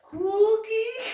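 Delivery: put-on high squealing call of a happy kid; a small boy yelling for his treat; excited child shout. Son spotting grandma's dog, squealing high and loud.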